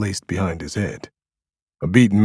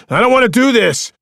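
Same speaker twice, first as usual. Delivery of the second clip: desperate high yell, much louder; 10 dB louder than usual. A narrator's reading. Diggs yelling at Cross to stop, desperate shout. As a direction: yell it to stop him shooting. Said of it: "Diggs yelled".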